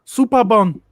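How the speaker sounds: put-on Superbon in a broad, high, loud Yorkshire accent. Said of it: "the way he says Superbon in the Yorkshire accent".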